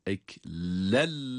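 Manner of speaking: "eklellemme" with a firm doubled l; "eklellemme" with the n merged into a strong l. Shown as a demonstration. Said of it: "şeddeli bir lam gibi okunuyor".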